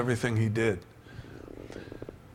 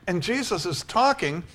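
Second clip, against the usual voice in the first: usual speaking voice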